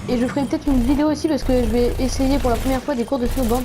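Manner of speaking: ordinary talk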